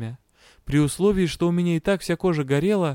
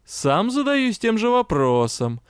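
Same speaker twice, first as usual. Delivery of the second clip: wry, higher and slower; mocking reply; 8 semitones higher than usual. A narrator's reading. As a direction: toss it back with irony. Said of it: "насмешливо ответил лорд Элахар".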